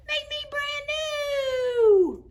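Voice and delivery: very high, slow, drawn-out eerie voice; puddle voice. A narrator's reading. A voice in the puddle begs the children, high and slow. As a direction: stretch it out like a magic puddle calling. Voice from the hot tub.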